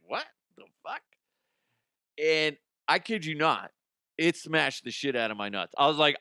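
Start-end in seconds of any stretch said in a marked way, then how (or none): 0.1-1.0 soft, higher puzzled mutter; a quiet baffled under-breath reaction; lifeguard's puzzled aside. Retold or imitated. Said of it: "she's just looking at me like".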